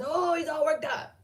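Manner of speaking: mocking friend's complaint. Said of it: "she would just be like".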